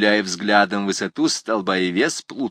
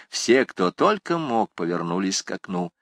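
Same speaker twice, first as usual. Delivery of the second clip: plain reading voice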